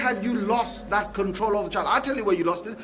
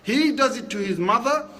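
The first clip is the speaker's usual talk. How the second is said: usual speaking voice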